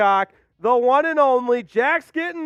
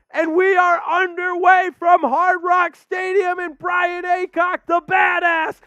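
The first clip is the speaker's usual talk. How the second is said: excited shouting; 6 semitones higher than usual. Real, not acted. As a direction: go full hype for a big donation. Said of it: "Whew, I'm out of breath".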